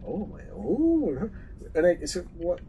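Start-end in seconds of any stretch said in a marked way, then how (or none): 0.1-1.3 slow, high mock shock; hammed-up clutch-the-pearls gasp. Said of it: "you go".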